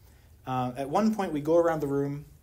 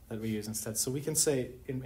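usual speaking voice

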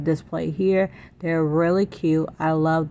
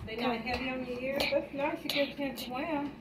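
tender mother voice; 9 semitones higher, 11 dB quieter than usual. Real, not acted. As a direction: speak sweetly to the little one. Talking tenderly to her child.

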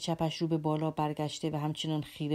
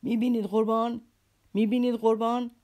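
higher, louder enraged roar; furious roar; 6 semitones higher, 6 dB louder than usual. A narrator's reading. Pale captain roars at Alyosha over the notes, furious. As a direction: roar it out in fury. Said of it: "رنگ پریده و خشمناک خروش برآورد".

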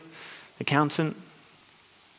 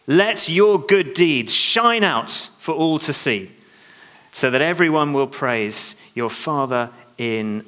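slow emphatic reading; slower than usual. Shown as a demonstration.

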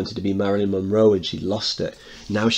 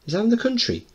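put-on high curious boy voice; young boy voice; faster than usual. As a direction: ask like a curious kid. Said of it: "Sammy speaking".

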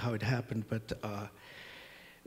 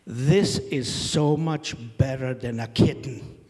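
loud, slow delighted shout over the wind; a kid shouting over rushing wind; excited yell; 11 dB louder, slower than usual. Retold or imitated. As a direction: yell it with joy from a fast car. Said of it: "she yells out".

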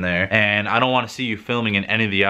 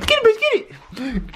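laughing hype; slower than usual. Real, not acted. Egging dog, crying laugh.

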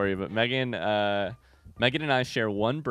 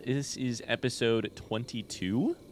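slow, with TWENTY-TWO swinging up at the end; odd questioning delivery; 3 dB quieter, slower than usual. Real, not acted. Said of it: "why I said it like that".

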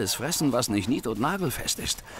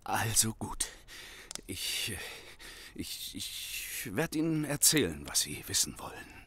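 softer, faltering confession; defeated whisper; 5 semitones lower, 7 dB quieter than usual. Acted. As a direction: give up and confess under your breath. Thief gives in, confessing.

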